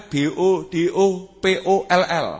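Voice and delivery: spelled out letter by letter, "B O D O P O L L"; mock spelling of an insult. Shown as a demonstration. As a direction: spell out "B O D O P O L L" slowly, one letter at a time. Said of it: "jangan dieja".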